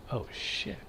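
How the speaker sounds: the floor drops out from under you; hushed guilty gasp of realization; stunned quiet realization. Retold or imitated. Realizing embarrassment.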